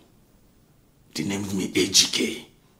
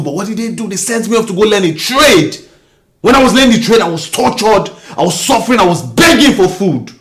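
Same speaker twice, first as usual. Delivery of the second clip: much higher, loud bitter cry; anguished shout; 9 semitones higher, 9 dB louder, faster than usual. Acted. Husband to wife about his childhood, anguished shout. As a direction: shout out the old suffering.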